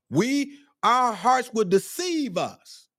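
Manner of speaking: high, drawn-out exhortation; worked-up preaching. Real, not acted. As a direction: preach it high and stretch the words. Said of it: "I'm getting worked up now".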